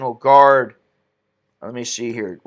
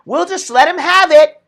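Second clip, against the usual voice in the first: mock official; 11 semitones higher, 4 dB louder than usual. Mocking officials' attitude.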